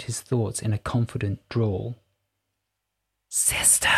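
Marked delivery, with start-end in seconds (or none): 3.3-3.9 whispered call from nowhere; a breathy fairy calling out; tinkly bell voice. A narrator's reading. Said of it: "A high-pitched, tinkling voice".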